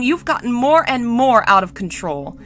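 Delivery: acted weary telling-off; exasperated reproach. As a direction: scold as if at the end of patience. Sighing, scolds cousin with aching head.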